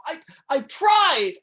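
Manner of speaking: high, loud, drawn-out cry; anguished shout, stretched out slowly. Acted. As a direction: burst out in desperate protest. Anguished protest of failed disciple.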